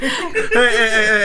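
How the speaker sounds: high, loud hey hey to cut in; loud protesting hey. Real, not acted. Friend cutting in to stop the blame, loud. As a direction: interrupt loudly to stop them.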